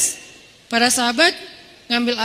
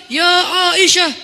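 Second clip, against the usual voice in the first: loud, high mock-stern call; angry pulpit preacher; 6 semitones higher, 6 dB louder than usual. Khatib reading to Friday crowd, angry declaiming. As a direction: thunder the name from the pulpit. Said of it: "Pas di atas mimbar ... khatib yang marah".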